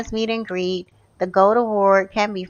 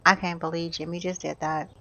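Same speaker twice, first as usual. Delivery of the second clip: low, soft dismay; subdued disbelief; 4 semitones lower, 8 dB quieter than usual. Real, not acted. Gamer reacts to Jimmy leaving, subdued disbelief. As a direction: say it quietly, let down.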